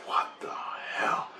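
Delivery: slow, drawn-out whisper; baffled whisper. Retold or imitated. Retelling his thought as guilt hit him, slow baffled whisper. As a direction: whisper it to yourself, bewildered.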